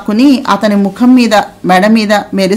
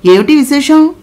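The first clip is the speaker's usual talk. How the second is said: relaxed smiling question; 6 semitones higher than usual. A narrator's reading. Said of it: "విలాసంగా ... చిరునవ్వుతో ప్రశ్నించాడు".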